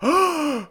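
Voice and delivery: loud, high theatrical gasp; mock shocked gasp. Co-host feigning horror at the revelation, exaggerated gasp. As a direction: suck in breath as if scandalised.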